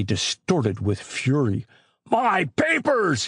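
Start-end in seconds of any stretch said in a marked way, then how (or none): 2.1-3.3 high, slow, drawn-out cry; an old man shrieking over his treasured papers; frantic shriek. A narrator's reading. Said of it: "he shrieked".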